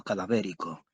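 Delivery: ordinary delivery, nothing marked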